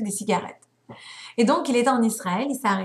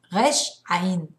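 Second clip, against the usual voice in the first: ordinary talk, nothing marked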